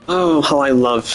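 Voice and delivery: ordinary talk, nothing marked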